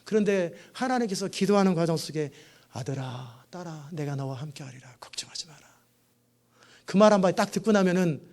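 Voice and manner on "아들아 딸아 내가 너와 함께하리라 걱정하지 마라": soft, hushed fatherly comfort, fading to a whisper; God comforting a weeping believer, soft and hushed; God's gentle reassurance. Acted. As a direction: reassure them tenderly, almost whispered. God's comforting answer voiced.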